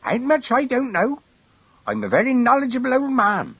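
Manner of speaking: put-on high piping old countryman; a small old man boasting. A narrator's reading. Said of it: "said old Harry".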